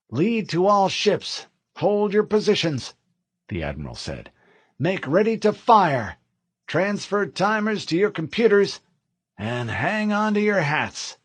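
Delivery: steady, measured command voice; cool fleet admiral over comms; calm admiral. A narrator's reading. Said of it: "calmly broke through the noise".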